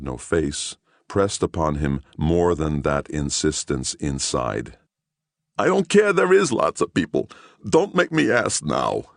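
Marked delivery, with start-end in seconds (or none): 5.6-9.1 desperate voice. Acted. Gerlich pleading with inner voice.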